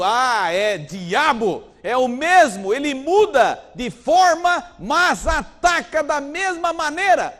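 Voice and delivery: loud, slow, high sermon cry; full-force preacher shout; fiery preaching. Acted. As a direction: thunder it from the pulpit.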